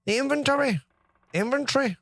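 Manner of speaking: put-on high, lilting try at an Irish accent. Testing how an Irish person says inventory, Irish accent. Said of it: "how would an Irish person".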